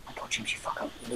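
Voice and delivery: whispered scolding, no voice at all. Real, not acted. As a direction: hiss the rebuke under your breath. Hushed scolding during game.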